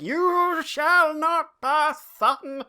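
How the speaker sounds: high, slow, loud wizard bellow; sputtering foiled villain. Retold or imitated. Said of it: "just like".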